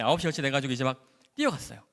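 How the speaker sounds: usual speaking voice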